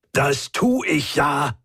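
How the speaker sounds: slow, drawn-out exasperated retort; mild bellow. A narrator's reading. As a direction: snap back in frustration, stretching the words. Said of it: "brüllte er".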